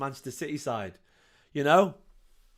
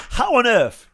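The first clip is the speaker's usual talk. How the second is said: astonished; 8 semitones higher, 5 dB louder than usual. Real, not acted. Fan reacting to a near own goal.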